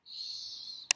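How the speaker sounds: soft breathy shush. Acted. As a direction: hush them gently. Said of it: "Gotta be super quiet".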